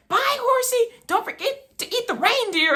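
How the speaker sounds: high, goofy sing-song mom voice; teasing mom. Retold or imitated. Mom calling out the car window, high and goofy. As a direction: ham up mom's silly goodbye. Mom's silly goodbye again.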